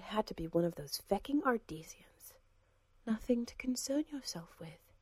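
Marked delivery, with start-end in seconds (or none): 3.1-4.9 soft, lightly voiced cool woman; quiet, a hint of a composed lady; woman's voice. A narrator's reading. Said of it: "That was a woman's voice".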